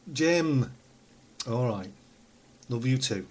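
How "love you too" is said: usual speaking voice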